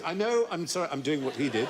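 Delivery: ordinary talk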